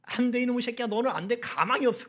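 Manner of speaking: hammed-up furious scolding; mock angry cursing. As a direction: act out a parent cursing a son. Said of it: "뭐".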